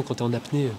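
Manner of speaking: ordinary delivery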